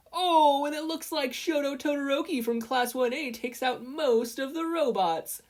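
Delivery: very high, loud hyped commentary; Present Mike. A narrator's reading. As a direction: shout it like a sportscaster. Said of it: "Present Mike announced".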